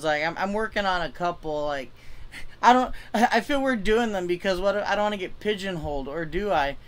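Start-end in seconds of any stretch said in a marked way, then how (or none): none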